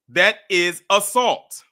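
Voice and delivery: loud, slow, final verdict; emphatic. Real, not acted. Closing his argument to the audience, emphatic and slow. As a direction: hammer each word down.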